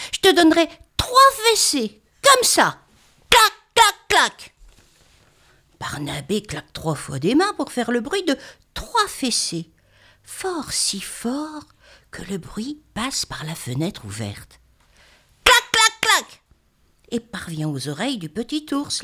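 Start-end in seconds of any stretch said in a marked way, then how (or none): 0.1-4.5 put-on loud, high threatening child; scolding child. Child scolding, clapping sounds. 15.4-16.4 high, loud clac clac; loud clapping sound. Acted.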